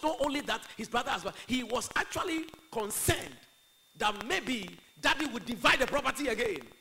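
loud, high-pitched forceful preaching. Acted. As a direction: press the argument on the congregation.

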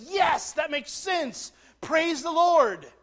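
loud, high, drawn-out mock rejoicing; exultant. Preacher voices believers hearing of the incarnation, exultant. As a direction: exclaim it like a believer hearing great news. Acted believers greeting the news.

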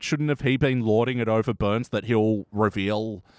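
usual speaking voice

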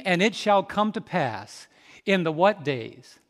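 ordinary delivery

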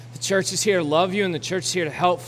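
ordinary talk

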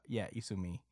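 normal talking voice

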